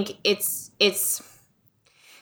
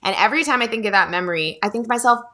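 ordinary talk, nothing marked

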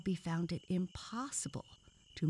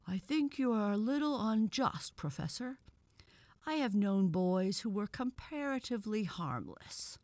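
higher-pitched, tentative little objection; timid; 6 semitones higher, 4 dB louder than usual. A narrator's reading. Toad gently defends boys to the professor, timidly. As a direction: disagree timidly with a superior. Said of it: "the toad near Bobby's ear spoke timidly".

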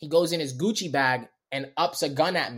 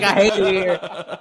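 laughing lament; 3 semitones higher, 6 dB louder, slower than usual. Retold or imitated. Laughing despair running from cop.

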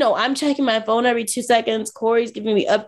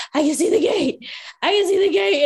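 raised-pitch breathless-news relay; mock urgent calling; 6 semitones higher than usual. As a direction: imitate urgently calling back to a running partner. Travel companion calls out while running.

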